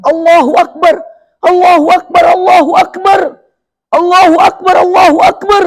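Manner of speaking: high, loud joyful cries of Allahu Akbar; rejoicing, calling God's greatness aloud; joyful takbir. A narrator's reading. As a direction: Abu Bakar shouting takbir in joy. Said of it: "bertakbir dengan takbir yang gembira".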